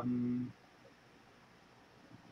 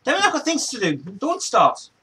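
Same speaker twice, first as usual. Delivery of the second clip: much higher, louder sing-song scolding; high pet-talk to the dog; 11 semitones higher, 7 dB louder than usual. Real, not acted.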